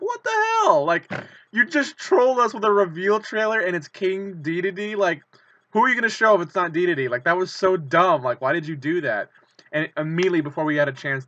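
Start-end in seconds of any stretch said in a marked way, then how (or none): 0.0-9.3 high, loud, disbelieving rant; mock-outraged at the trolling trailer; incredulous outrage. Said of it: "and we're like".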